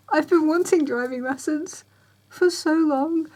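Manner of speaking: high voice, welling up; getting emotional. Real, not acted. Tired learner overcome about long-awaited lessons, emotional high voice. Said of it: "Sorry, I'm getting emotional because I'm tired".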